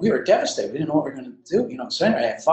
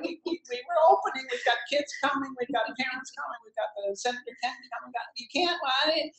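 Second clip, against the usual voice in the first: high, pleading mock-tearful voice, falling softer; imitating crying plea; 10 semitones higher, 6 dB quieter, faster than usual. Retelling pleading tearfully with the fire chief, high and mock-tearful. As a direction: replay your tearful begging, half laughing. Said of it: "And I'm crying, literally".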